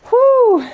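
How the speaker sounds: whoo cheer. Real, not acted. Cheering self through the reps.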